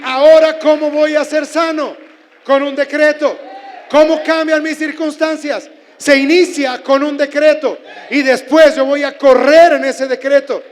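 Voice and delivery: loud, high preaching shout; preacher rallying the congregation, shouting; full-force preaching. Acted.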